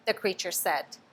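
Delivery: plain reading voice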